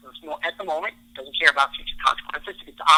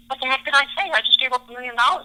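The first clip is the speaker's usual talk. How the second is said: high-pitched, hurried disbelief; hammed-up shocked regret; mock dismay; 9 semitones higher, faster than usual. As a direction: kick yourself in comic regret. Later regret, mocked.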